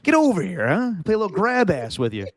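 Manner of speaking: mock beckoning, like a coach collaring his player; imitating coach. Host replays coach greeting Gannon, playful high mimicry.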